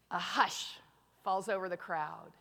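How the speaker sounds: low, soft storytelling; hushed dramatic narration. Retold or imitated. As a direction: drop right down for suspense. Narrates the crowd falling silent.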